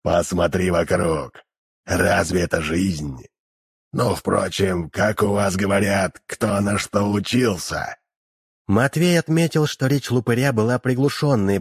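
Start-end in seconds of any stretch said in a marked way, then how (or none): 0.0-8.0 low, slow muffled demon voice; eldritch horror. Acted. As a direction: speak from the depths of hell, muffled. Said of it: "звучал из самых глубин ада".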